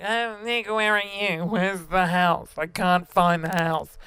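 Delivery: put-on slow, slurred drunken whine; stumbling drunk. Imitating drunk self calling Nick, lost outside, slurred drunk whine. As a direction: drag the words out, drunk and lost. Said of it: "and it was, like, stumbling".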